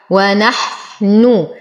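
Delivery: slow ونحن with a held, airy ha; lingering ha. Shown as a demonstration. Teacher reciting for students, ونحن with lingering ha. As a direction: dwell on the ha of ونحن, then full u. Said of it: "نصبر على الحاء".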